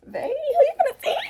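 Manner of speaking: high mock challenge; teasing high punchline. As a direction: toss it off teasingly.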